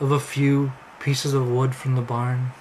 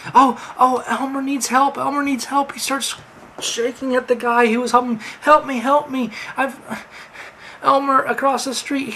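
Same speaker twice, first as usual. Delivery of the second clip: desperate; 9 semitones higher than usual. Acted. Quinter begging for help.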